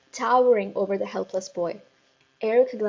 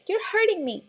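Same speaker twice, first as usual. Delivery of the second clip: much higher, pained plea; pained boy; 11 semitones higher than usual. A narrator's reading. Eric pinched by the ears, much higher. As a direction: whimper it high.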